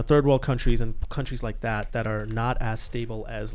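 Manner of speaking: usual speaking voice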